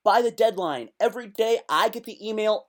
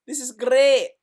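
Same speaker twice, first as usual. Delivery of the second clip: loud delight; 6 semitones higher, 7 dB louder than usual. Real, not acted.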